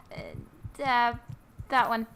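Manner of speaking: ordinary talk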